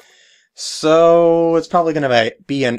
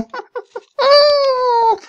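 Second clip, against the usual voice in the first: very high villain cackle; shrieking triumphant cackle; evil laugh. Acted. Evil guy laughs at victory.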